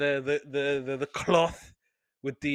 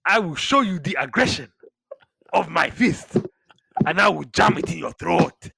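loud, higher imitation of an African accent; 3 semitones higher, 5 dB louder than usual. Co-host mimics the wrestler's promo threat in an African accent. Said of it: "started doing an African accent".

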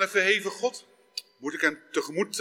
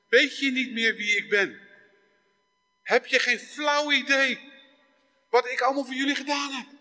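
higher, hurt disbelief; desperate parent; 6 semitones higher than usual. Acted. God as desperate parent to his child, higher and hurt. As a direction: ask it like a wounded parent. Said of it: "de wanhopige ouder die naar zijn kind zegt".

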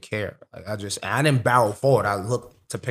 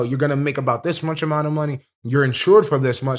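ordinary talk

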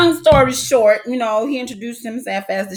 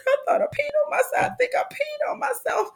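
high, softer wail; high panicked confession; 7 dB quieter than usual. Retold or imitated. Daughter confessing to her mom in panic. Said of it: "I said".

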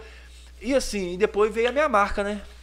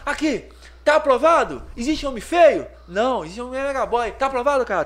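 higher, louder hype call; hyped-up showman; 6 semitones higher, 6 dB louder than usual. Retold or imitated. Friend Juliano hyping drivers at the traffic light, loud. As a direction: work the crowd of drivers.

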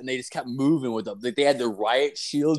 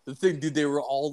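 ordinary talk, nothing marked

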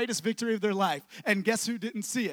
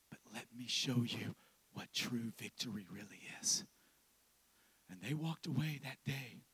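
soft, low, voiceless whisper; sermon whisper; 10 semitones lower, 12 dB quieter than usual. Acted. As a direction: draw them in with a hush. Whispered intimate stretch.

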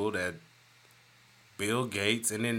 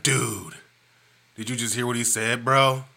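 laid-back dude voice, slightly louder; dude guy Wesley; 2 semitones higher, 6 dB louder than usual. Retold or imitated. Friend imitated right, as a laid-back dude. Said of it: "He's more of a dude guy".

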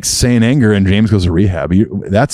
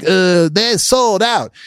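mocking whiny fan; 10 semitones higher, much slower than usual. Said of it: "some people are like".

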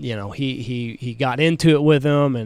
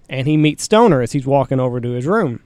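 ordinary delivery

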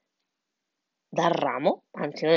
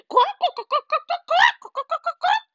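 very high, frantic hen clucks; clucking hen; 4 dB louder than usual. A narrator's reading. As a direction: cluck wildly, climbing higher. (coccodè acutissimo e agitato)

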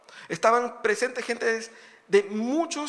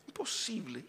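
whispered; 10 dB quieter than usual. Real, not acted. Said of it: "repeated imposible, whispered".

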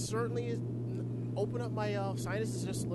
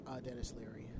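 whispered aside; 9 semitones lower, 9 dB quieter, faster than usual. Real, not acted. Aside after joke.

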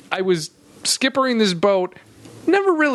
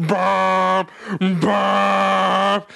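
horn honk. Retold or imitated. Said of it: "going like".

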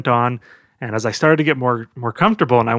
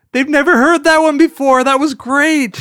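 high, loud delivery breaking into laughter; laughing hard; 12 semitones higher, 6 dB louder than usual. Acted. Cellmate reacting to a brand-new joke, laughing. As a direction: crow it out while cracking up.

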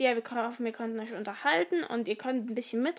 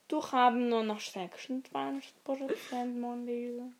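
ordinary delivery

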